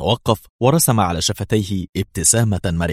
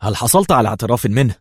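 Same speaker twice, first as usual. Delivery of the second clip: raised, keen question; interested; 4 semitones higher, 4 dB louder, faster than usual. A narrator's reading. Intelligence chief asking his aide about a confession, with interest. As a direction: ask it wanting the answer. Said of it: "وسأله في اهتمام".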